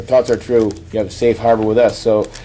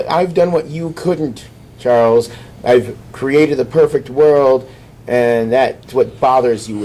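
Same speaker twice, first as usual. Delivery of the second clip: slow, gloating Cyclops; slower than usual. Retold or imitated. Cyclops lecturing Professor X.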